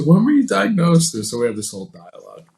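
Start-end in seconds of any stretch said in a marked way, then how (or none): 0.0-1.1 quoting the tech. Retold or imitated. Quoting the MRI tech's question.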